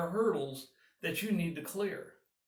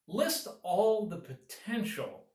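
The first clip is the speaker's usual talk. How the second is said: "potential" drawn out slowly and lifted higher; stress on potential; 5 semitones higher, 3 dB louder, slower than usual. Shown as a demonstration. Advisor stressing the obstacles are only potential, slow, raised stress. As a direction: lean hard on "potential", slow and high. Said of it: "I emphasize the word potential".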